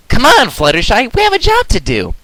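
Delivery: a high cartoon pony rallying her partner; Rainbow Dash urging. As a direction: hurry a shy friend along. Rainbow Dash urges Fluttershy.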